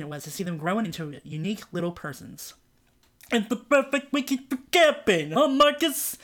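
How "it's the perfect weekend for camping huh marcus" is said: dopey high voice; stupid tone. A narrator's reading. Man to his brother, in a dopey high voice. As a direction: play it dumb and pitched up. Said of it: "a man said to his brother in a stupid tone".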